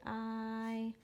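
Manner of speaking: "I" held long as its letter name; long I sound. Shown as a demonstration. Teacher showing the vowel's name, "I" held long. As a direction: draw out "I" on a steady tone, like eye. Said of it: "make the I say its name".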